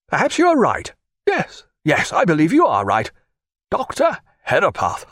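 lightly voiced anxious old father; Herapath's voice. A narrator's reading. Herapath asks the doctor's advice, lightly voiced and fretful.

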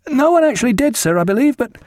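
put-on a scared boy blurting at the door; frightened midshipman. A narrator's reading. Midshipman at the cabin door, high and frightened. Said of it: "frightened midshipman".

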